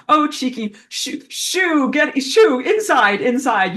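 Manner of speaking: high, loud shooing the dog in. Retold or imitated. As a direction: call the dog inside, quick and firm. Calling dog inside.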